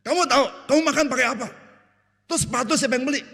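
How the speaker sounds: high, loud, exasperated dad voice; an offended father shouting back at his child; hammed-up angry father; indignant father. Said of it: "Papa bilang gini".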